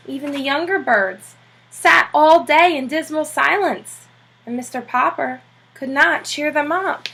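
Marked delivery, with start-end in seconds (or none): none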